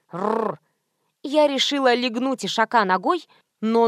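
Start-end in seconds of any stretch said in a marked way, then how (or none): 0.1-0.6 imitated rolled animal grunt; donkey snort. A narrator's reading. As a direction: answer with a donkey's rumble. Donkey answers.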